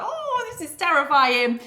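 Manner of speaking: high, loud mock-terrified wail; mock scream. Coach imitating you screaming all the way, mock terror. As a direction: laughingly act out screaming in terror. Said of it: "you'll be screaming all the way".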